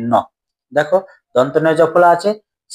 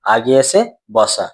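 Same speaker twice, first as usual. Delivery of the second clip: slow definition; 4 semitones lower, slower than usual. Shown as a demonstration. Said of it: "অপিনহিতি শব্দের অর্থ কি?".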